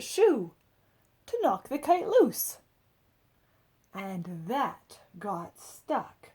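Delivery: expressive read-aloud storytelling; picture book narration. A narrator's reading. Adult reading a picture book to children, lively storybook voice. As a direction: tell it like a bedtime story for kids.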